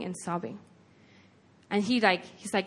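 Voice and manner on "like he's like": ordinary delivery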